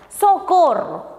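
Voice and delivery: a teacher acting out a call for help to show an exclamation; desperate cry for help. Shown as a demonstration. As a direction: cry Socorro like someone in trouble. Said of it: "es un auxilio, una desesperación".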